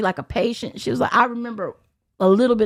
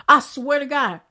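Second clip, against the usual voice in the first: ordinary delivery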